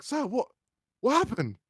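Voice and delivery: high, startled question; baffled kid asking what went wrong; confused. Retold or imitated. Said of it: "I'm like".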